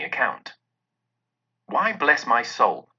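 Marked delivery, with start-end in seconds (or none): none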